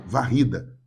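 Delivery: ordinary talk, nothing marked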